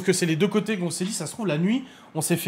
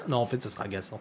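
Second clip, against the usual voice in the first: ordinary talk, nothing marked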